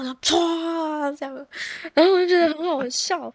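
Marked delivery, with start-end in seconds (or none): 0.0-1.2 slow, high imitated gush; drawn-out whoosh. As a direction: stretch the whoosh sound out. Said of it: "我第一次怀孕不知道". (慢慢拉长的“歘”声)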